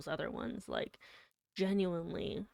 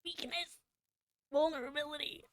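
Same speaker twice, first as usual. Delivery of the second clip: much higher, sarcastic listing; mock dramatic; 9 semitones higher than usual. Streamer teasing herself for crying, sarcastic high voice. Mocking her own emotions.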